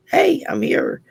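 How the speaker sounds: ordinary talk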